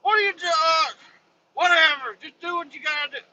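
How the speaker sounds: high fed-up coworker voice; mock exasperated worker. Worker giving up on the driver, high fed-up voice. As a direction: throw up your hands, pitched high.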